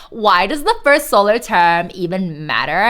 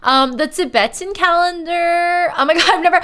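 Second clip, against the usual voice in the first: normal talking voice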